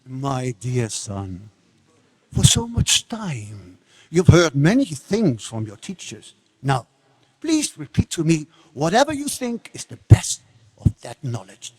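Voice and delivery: slow, low and soft fatherly coaxing; a king luring his son with sweetness; honeyed, patient fatherly voice. Acted.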